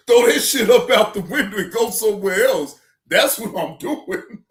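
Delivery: loud laughing rant. Real, not acted. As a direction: push it out loud through laughter. Laughing while telling it.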